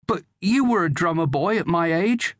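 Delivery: high-pitched, protesting lad; arguing boy. A narrator's reading. Son challenging father to let him fight, arguing. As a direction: argue back as a young son. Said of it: "argued Daniel".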